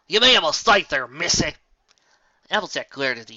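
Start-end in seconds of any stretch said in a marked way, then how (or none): 0.1-1.6 loud challenge. Acted. Applejack challenging the unicorn.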